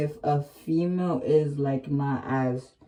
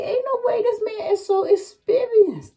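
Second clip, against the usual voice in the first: much higher, stunned disbelief; stunned inner voice. Retold or imitated. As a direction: say it as your own amazed thought back then. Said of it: "I was sitting there like".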